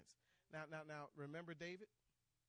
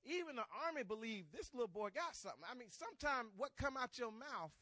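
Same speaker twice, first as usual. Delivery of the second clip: raised, high-pitched preacher delivery; forceful preaching; 6 semitones higher, 6 dB louder than usual. Real, not acted. Preacher to the church on David's bold words, forceful preaching. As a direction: press the point on the congregation.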